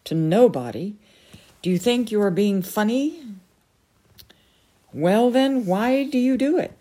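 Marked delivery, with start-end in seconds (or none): 1.6-6.7 louder, higher scolding; irritated wizard scolding the air; furious. A narrator's reading. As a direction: rant at the empty air. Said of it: "said Merlin furiously".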